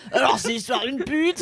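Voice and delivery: hammed-up comedian launching a crude joke; amateur mimic of a stand-up comic; bad Bigard imitation. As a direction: do a poor comic impression, half laughing. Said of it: "Ton imitation est pire que tout".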